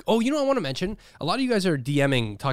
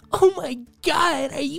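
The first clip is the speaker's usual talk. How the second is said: high, drawn-out mock amazement; 14 semitones higher, slower than usual. Singer on stage winning another award, mock astonished. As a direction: gush in exaggerated wholesome disbelief. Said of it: "she's still on stage going".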